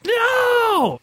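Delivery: high-pitched, drawn-out wail; mock cry of no. Mock outburst of protest.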